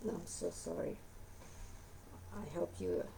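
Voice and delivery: usual speaking voice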